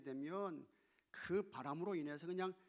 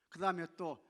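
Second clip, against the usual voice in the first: usual speaking voice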